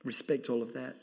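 ordinary talk, nothing marked

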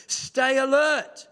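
normal talking voice

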